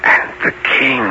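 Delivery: breathy whisper into the ear; cupped hand whisper. Acted. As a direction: whisper it close and secret. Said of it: "Let me whisper this into your ear".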